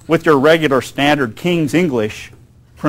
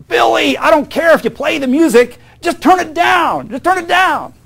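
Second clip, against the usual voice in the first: put-on higher, strained call to a teenager upstairs; mock nagging parent; 10 semitones higher than usual. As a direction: nag Billy to turn the music down.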